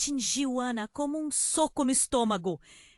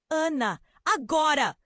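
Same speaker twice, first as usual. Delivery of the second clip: louder, higher urgent call; grito urgente; 5 semitones higher, 5 dB louder than usual. A narrator's reading. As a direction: shout it as an order. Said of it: "Alberto gritou". (grito de urgência, mais alto e agudo)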